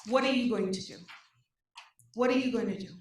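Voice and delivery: ordinary talk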